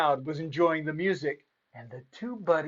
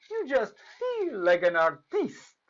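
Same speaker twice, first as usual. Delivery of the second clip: lightly mock French artiste; 6 semitones higher than usual. Host in an artist's hat plays a mock French artiste. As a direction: put on a hint of French flair. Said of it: "you can speak the French".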